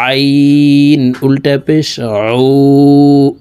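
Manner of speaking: আইন pressed from the middle of the throat; আইন sounded with throat pressure, unvoiced and breathy; Arabic ain sound. Shown as a demonstration. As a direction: squeeze উ out of the mid-throat for আইন. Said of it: "হলকের মধ্যখান থেকে চাপ দিয়ে পড়তে হবে".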